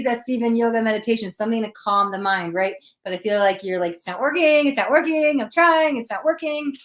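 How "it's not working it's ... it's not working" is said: higher, louder mock complaint, playing a frustrated client; pretend frustration. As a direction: whine that nothing works, hammed up. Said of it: "I'm a little frustrated".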